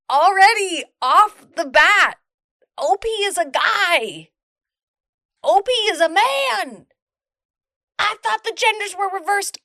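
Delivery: stunned, spelling out the realisation; excited disbelief. Real, not acted. Realises she misread the post.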